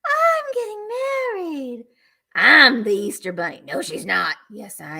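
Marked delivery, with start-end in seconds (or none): 0.1-3.6 a high, slow, put-on sassy voice that drops for the second line; sassy bride angel. Seller voicing the sassy June angel for viewers, high and slow. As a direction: play the bride angel claiming the spotlight. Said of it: "She's like".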